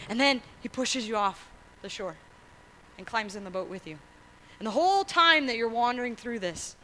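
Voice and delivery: ordinary delivery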